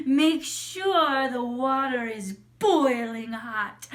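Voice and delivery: slow, loud, menacing witch command; bossy old witch ordering a servant; Baba Yaga. Acted. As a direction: stretch every word with wicked relish. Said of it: "Baba Yaga said".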